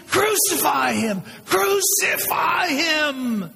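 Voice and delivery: high crowd shout, drawn out long at the end; angry mob shout building to a wail; screaming mob. Acted. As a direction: stretch the final cry into a long, high scream. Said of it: "they started screaming".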